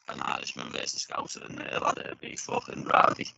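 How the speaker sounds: garbled digital voice as the line breaks up; robotic audio. Real, not acted. Fighter talking up an Anfield fight over a broken line, robotic audio. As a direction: sound like a glitching video call. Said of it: "you've sounded robotic".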